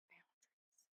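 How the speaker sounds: Boundaries whispered, breathy and voiceless. Shown as a demonstration. Repeats the word, then whispers it.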